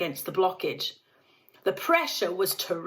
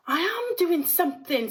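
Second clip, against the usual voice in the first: indignant father; 10 semitones higher than usual. A narrator's reading. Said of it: "said Mister Gloop".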